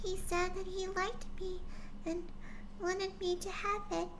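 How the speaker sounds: put-on high, soft young filly voice; little filly answering her father, high and soft; quietly.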